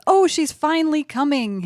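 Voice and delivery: high, thrilled mock dad; overjoyed at the news; excited dad. Dad telling mom, high and thrilled. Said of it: "Dad says".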